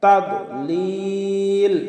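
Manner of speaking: "তাদলিল" with a very long held "লিল"; long madd. Shown as a demonstration. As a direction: chant slowly, hold the last vowel of "তাদলিল" three counts. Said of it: "লিলে তিন আলিফ টান হবে". ("তাদলিল"-এর "লিল"-এ তিন আলিফ লম্বা টান)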